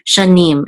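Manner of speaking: Shanim with the weight on -nim; stress on the last syllable. Shown as a demonstration. Teacher showing where Shanim is stressed. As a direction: lean on the end of Shanim. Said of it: "the accent mark is on the last syllable".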